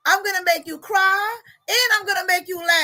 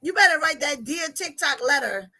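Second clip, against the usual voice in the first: usual speaking voice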